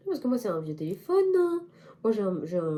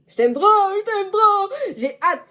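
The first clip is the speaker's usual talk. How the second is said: high, loud and slow, with a cry; high slow gushing fan cry; 13 semitones higher, 9 dB louder, slower than usual. Real, not acted. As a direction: gush over him like a devoted fan. Gushing at youtuber.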